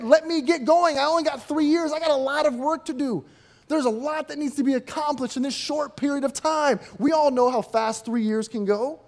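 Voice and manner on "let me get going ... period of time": higher, louder, impatient eagerness; itching to get going; eager rush. Retold or imitated. Preacher imagining himself as an impatient Jesus. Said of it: "I'd be chomping at the bit".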